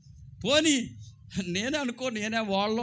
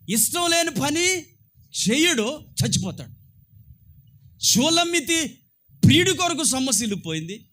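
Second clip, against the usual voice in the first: loud, raised preaching voice; fiery preaching; 5 semitones higher, 6 dB louder than usual. Acted. Preacher urging the church to obey God, loud and rising. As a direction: preach it hard to the congregation.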